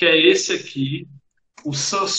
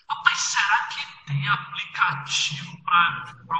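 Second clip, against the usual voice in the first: distant audio; 6 dB quieter than usual. Real, not acted. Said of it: "De vez em quando ele fica estranho, como se fosse distante".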